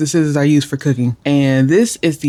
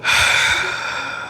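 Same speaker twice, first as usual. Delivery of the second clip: long soft breathy exhale; deep sigh; 5 dB quieter than usual. Real, not acted. Calming herself with viewers, a long deep sigh. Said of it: "take another collective deep breath".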